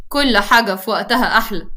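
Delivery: ordinary delivery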